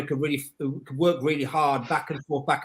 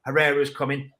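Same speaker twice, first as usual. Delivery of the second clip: ordinary talk, nothing marked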